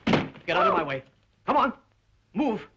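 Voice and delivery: loud, forceful short commands; angry threat. Acted. Cornered crook ordering police aside, threatening. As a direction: order them aside with rising urgency.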